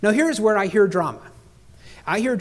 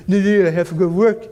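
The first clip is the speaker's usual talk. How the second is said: louder mock teen whine; pouty teenage daughter; 5 dB louder than usual. Daughter insists to dad on going to work, pouty. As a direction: protest in an imitated sulky teen voice. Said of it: "that's how they talk".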